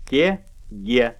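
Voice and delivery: ordinary talk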